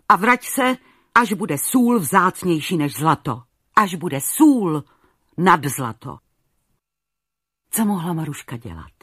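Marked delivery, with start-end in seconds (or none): 0.1-6.2 thundering king. Acted. King banishing his youngest daughter, thundering in fury. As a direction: roar her out of his sight. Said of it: "zaburácel".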